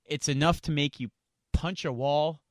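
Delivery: ordinary talk, nothing marked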